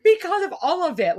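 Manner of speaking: high, loud, half-laughing despair; overwhelmed outburst. Retold or imitated. Mom answering her kid, loud, teary outburst. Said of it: "I'm like".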